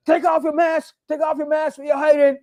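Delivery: high-pitched mocking jeer at the agents; cursing them out. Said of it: "she cursed the hell out of them".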